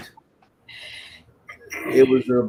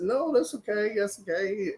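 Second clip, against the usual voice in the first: high, soft little decline; nervous polite refusal; 8 semitones higher, 7 dB quieter than usual. Retold or imitated. DJ declines a beer from bottle-buster, high soft refusal. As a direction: back out in a small high voice.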